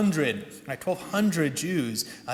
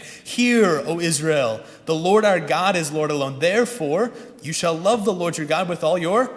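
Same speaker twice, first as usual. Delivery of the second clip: louder, measured recital of sacred words; scripture recitation; 4 dB louder than usual. Acted. Pastor reciting the Shema to his congregation, loud and solemn. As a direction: proclaim it like a reading from the pulpit.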